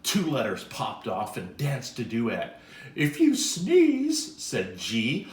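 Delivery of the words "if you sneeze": high, loud and slow; lilting storybook letter voice; character voice. Acted.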